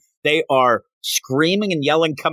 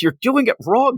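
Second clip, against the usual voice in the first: mock yell; 10 semitones higher than usual. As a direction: imitate an exasperated yell without full volume. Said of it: "Doc Ock yells".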